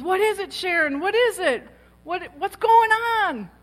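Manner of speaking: high, loud, urgent questioning; alarmed friend. Retold or imitated. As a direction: imitate a frantic friend, drawing out the last question. Alarmed friend questions crying speaker.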